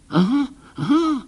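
vysoký, hraný hlas starého pána; rozespalý starý pán, vyšší hlas; starý doktor. Acted. Doktor probuzen ze spánku. (rozespalý starý doktor)

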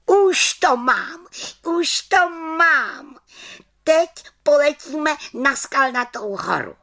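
excited little witch voice; triumphant little witch. Acted. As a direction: exclaim it high and gleeful. Witch triumphant at finding spell.

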